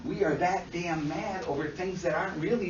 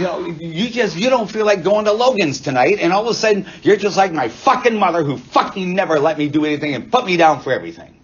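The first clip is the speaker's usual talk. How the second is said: loud, furious rant about a controlling mother; acted angry outburst; 12 dB louder than usual. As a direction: let the old anger explode at the partner. Acted fight over restaurant choice.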